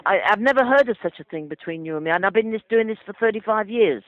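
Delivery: usual speaking voice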